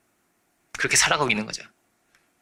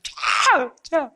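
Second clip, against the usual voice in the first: high-pitched demonstration yell; acted louder cry; 12 semitones higher, much slower than usual. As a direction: cry out high, as if over a crowd. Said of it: "더 크게 소리 지르는 거예요". (군중 너머로 높게 소리 지르는 흉내)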